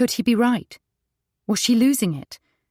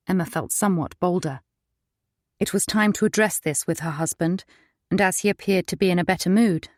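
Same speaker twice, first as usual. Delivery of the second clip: ordinary delivery, nothing marked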